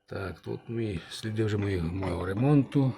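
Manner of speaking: ordinary delivery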